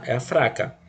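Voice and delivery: normal talking voice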